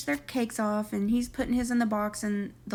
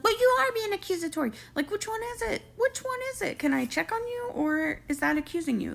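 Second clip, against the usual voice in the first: much higher-pitched imitation of arguing back; mock indignant girlfriend; 12 semitones higher than usual. Girlfriend arguing back at her man over checking on her, mock indignant. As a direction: squeal it like an exasperated girlfriend. Retelling an argument, acting the girlfriend.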